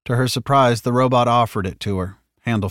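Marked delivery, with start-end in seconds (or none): none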